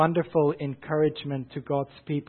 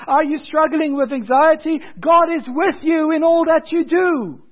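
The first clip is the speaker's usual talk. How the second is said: high, loud, ringing preacher's call; fervent sermon delivery, pitched high; preaching at full force; 9 semitones higher, 5 dB louder than usual. Acted. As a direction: preach it out to the whole church. Preacher addressing congregation.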